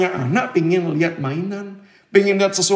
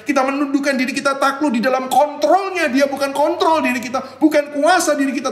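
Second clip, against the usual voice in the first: preaching; 6 semitones higher than usual. Acted. Preaching the lesson.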